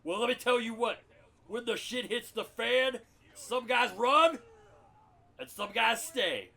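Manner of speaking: slow, loud gruff colonel speech; Frank Slade. Acted. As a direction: drag each phrase out like a war hero. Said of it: "Lieutenant Colonel Frank Slade".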